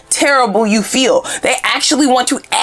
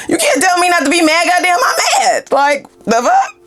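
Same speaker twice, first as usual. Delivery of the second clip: shouting that she's allowed to be mad; 9 semitones higher than usual. Real, not acted. Ranting to viewers about toxic positivity, shouting. Insisting she has a right to anger.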